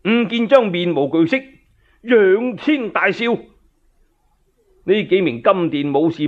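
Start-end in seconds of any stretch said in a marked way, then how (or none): none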